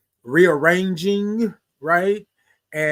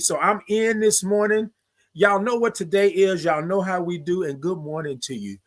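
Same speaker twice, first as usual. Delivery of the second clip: ordinary talk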